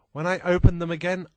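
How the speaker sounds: usual speaking voice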